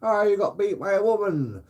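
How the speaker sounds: high jeering mock taunt; mocking onlooker. Mocking bystander teases a man beaten by a woman, high jeer. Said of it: "everyone goes".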